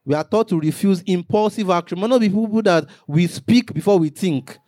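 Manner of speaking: normal talking voice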